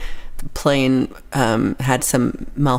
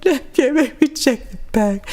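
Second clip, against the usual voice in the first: high, tearful mock protest; imitating a tearful plea, pitched high; mock crying; 10 semitones higher than usual. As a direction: whimper it like a hammed-up crying fit. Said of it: "I just started crying".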